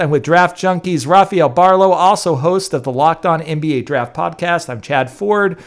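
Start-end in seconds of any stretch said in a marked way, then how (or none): 0.0-2.7 announcer intro. Acted. Host returns from break.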